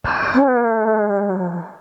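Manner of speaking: rolling purr like a cat. Shown as a demonstration. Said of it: "and say".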